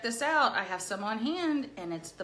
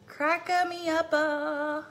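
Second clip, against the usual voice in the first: slow, higher, louder nonsense word; silly goofy voice; 6 semitones higher, 4 dB louder, much slower than usual. Acted. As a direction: play the clown.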